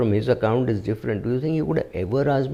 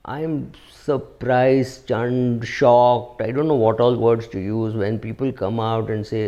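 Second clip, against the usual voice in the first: ordinary delivery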